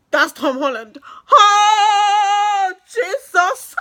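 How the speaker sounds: high, loud starstruck cry of disbelief; Oh Jesus shrieked high and loud. Retold or imitated. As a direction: scream it like you just saw a celebrity. Retold moment of recognition.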